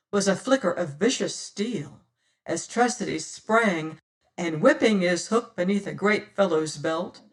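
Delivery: ordinary delivery, nothing marked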